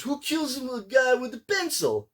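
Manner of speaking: high, strained mock Russian accent. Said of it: "that's my best Russian accent".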